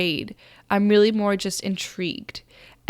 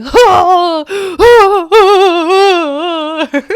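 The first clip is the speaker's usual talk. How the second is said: put-on high, loud anguished wails of an old woman; furious wailing; 11 semitones higher, 12 dB louder, much slower than usual. Possessed by an old woman, wailing in fury at the loss. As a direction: let out a raging old woman's wail, much louder and higher. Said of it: "So wailing, wailing, furious".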